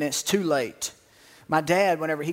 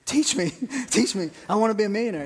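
put-on eager kid; 4 semitones higher, faster than usual. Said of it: "He's like".

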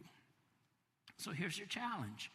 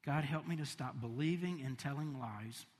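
softer, hushed prayer voice; 4 semitones lower, 5 dB quieter than usual. Acted. Preacher leading a closing prayer over music, hushed. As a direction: pray it quietly over them.

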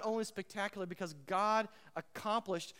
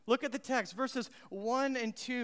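ordinary delivery

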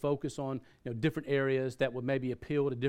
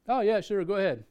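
higher, easygoing yes; breezy agreement; 6 semitones higher than usual. Retold or imitated. Man agreeing to hear the pastor read, breezy. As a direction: readily wave them on. Listener readily agrees in retold chat.

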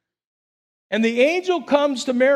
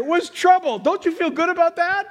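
excited; 9 semitones higher than usual. Acted. Preacher rising excitement.

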